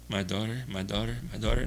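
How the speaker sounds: hushed motherly "my daughter"; Naomi's tender way. Retold or imitated. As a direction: murmur "my daughter" gently, as Naomi would. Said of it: "that's what Naomi called her".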